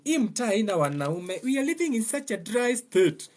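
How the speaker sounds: mock complaining woman. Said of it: "when you get home".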